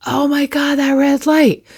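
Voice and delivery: imitating a driver fuming at a red light; mock road rage. Driver fuming at a red light, a touch of mock rage. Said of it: "I can't be like".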